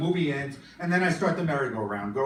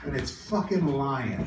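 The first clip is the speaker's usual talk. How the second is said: ordinary talk, nothing marked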